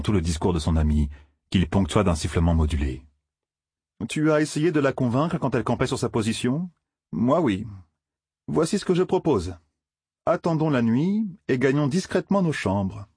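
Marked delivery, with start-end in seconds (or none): 4.1-13.1 voix plus haute que la narration; jeune farceur conspirateur. Acted. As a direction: propose ton plan sur un ton haut. (garçon qui complote, voix haute)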